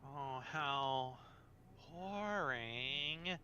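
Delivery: teasing, bored vampire voice; sultry vampire. Acted. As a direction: purr it out, mocking the prude.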